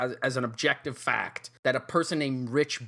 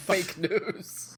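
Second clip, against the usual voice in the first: laughing; 5 semitones higher than usual. Real, not acted. Mocking joke while laughing.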